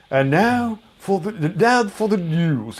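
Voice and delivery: lightly mocked posh BBC newsreader, slowed; a hint of a plummy wartime radio voice; mock posh news announcement; posh British announcer. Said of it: "It was a really posh accent".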